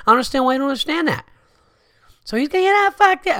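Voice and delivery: put-on high, loud, fast drunken yelling; hammed-up high shouting between buddies; mock screaming match. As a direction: squeal it like a drunk mid-argument. Screaming argument, both voiced.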